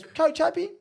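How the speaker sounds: very high-pitched coach impression; high mock-coach questioning; imitating the coach. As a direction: squeak out an interrogation as the coach. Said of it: "He goes".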